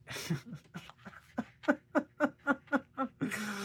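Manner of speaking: long high laugh. Real, not acted. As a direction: laugh hard. Laughs at own story.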